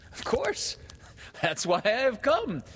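put-on high, emphatic boy's reply; a little stern. A narrator's reading. Peter tells Wendy why he has come, a little sternly. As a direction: insist loudly, a little sternly. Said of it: "he added a little sternly".

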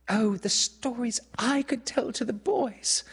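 put-on high girlish exclamation; eager cry. A narrator's reading. Wendy tempting Peter with stories, high eager cry. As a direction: cry it out high, full of promise. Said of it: "she cried".

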